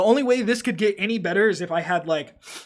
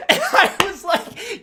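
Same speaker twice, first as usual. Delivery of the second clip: laughing; 14 semitones higher, 7 dB louder, slower than usual. Real, not acted. Laughing while telling.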